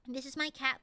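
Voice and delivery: ordinary delivery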